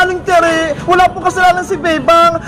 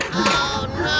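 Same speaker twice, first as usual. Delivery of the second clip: much softer, lower dismayed oh no; worried murmur; 4 semitones lower, 10 dB quieter than usual. Acted. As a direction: breathe it out in dread. Children dismayed, scooter taken.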